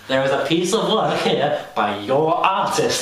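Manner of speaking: slow, mock condescending drawl. Teen mimicking the guide addressing Americans, slow condescension. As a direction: talk down to them slowly. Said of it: "she's so condescending".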